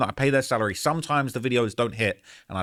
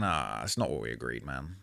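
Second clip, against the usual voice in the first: muttered to himself; 11 semitones lower, 10 dB quieter than usual. Retold or imitated. Retold inner thought, upset.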